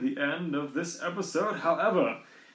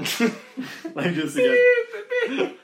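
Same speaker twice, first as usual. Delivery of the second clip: laughing; 9 semitones higher, 6 dB louder, slower than usual. Real, not acted. Laughing at own blunder.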